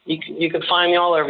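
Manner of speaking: normal talking voice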